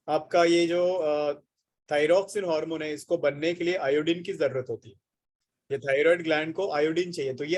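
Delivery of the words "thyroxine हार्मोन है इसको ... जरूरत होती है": ordinary talk, nothing marked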